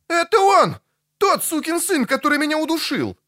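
very high, agitated outcry; shrill up in alarm, rushing by the end; panicked exclamation. A narrator's reading. Bard pointing out the creature that strangled him, panicked exclamation.

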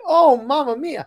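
hammed-up high, loud Italian accent; big, slow mock-Italian exclamation. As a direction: draw it out like a delighted cartoon Italian. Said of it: "this very nice Italian".

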